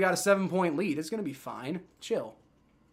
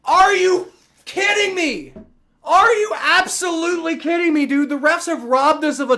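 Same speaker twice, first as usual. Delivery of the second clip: outraged yell; 9 semitones higher, 7 dB louder than usual. Real, not acted. Streamer reacting to the Patriots touchdown, outraged yell. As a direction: drag it out in furious disbelief.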